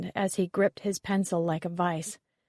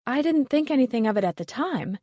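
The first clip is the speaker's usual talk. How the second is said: higher and louder, eager to help; excited; 6 semitones higher, 4 dB louder than usual. A narrator's reading. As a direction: rush it out with excitement. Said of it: "she continued excitedly".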